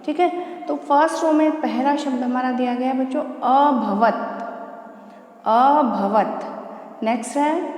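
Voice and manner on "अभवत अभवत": अभवत said syllable by syllable, a-bha-vat, the second time very slowly; careful Sanskrit pronunciation. Shown as a demonstration. Teacher slowly models अभवत for her class, carefully. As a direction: stretch out अभवत clearly so students can copy. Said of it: "उच्चारित करके दिखा देती हूँ".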